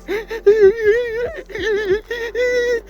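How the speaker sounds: high wailing fake cry; hammed-up high sob; mock sobbing wail. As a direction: imitate a kid bawling. Imitating brother sobbing.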